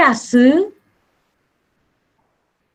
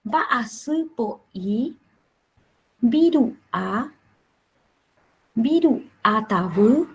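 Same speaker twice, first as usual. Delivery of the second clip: each Guarani word said slowly, with long pauses between; deliberate classroom pronunciation drill; slow word list with pauses; much slower than usual. Shown as a demonstration. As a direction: read the words slowly so students can repeat. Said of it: "vuelvo a repetir".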